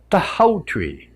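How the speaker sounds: slow hushed whisper; whispered realization. Acted. Maui solving the riddle, slow hushed whisper. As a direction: breathe the answer like a secret.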